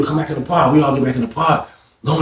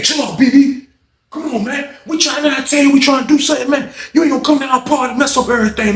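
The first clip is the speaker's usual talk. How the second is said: pitched-up voice begging BB to chill; calm him down in a high plea; high pleading friend; 10 semitones higher than usual. Retold or imitated. Lawrence pleading with BB to chill out, high voice.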